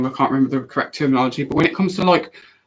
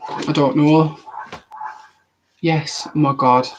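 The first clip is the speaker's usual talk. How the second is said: usual speaking voice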